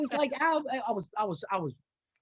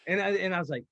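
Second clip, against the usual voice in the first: ordinary talk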